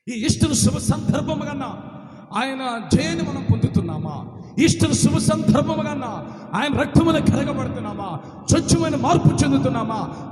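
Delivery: loud, searching questions, slowing at the end; forceful preaching. Acted. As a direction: press each question on the hearers. Preacher challenges congregation. (గట్టిగా ప్రశ్నిస్తూ బోధన)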